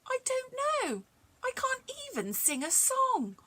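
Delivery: put-on high, light little girl voice; young Mary, pitched high; Mary's voice. A narrator's reading. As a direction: lift into a small girl's voice. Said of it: "Mary said".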